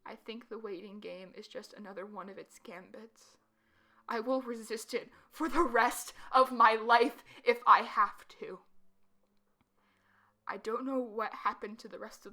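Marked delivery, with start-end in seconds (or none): 0.1-3.4 hushed conspiratorial whisper. Acted. Secretive suspicion. 4.1-8.6 loud defiant vow. Acted.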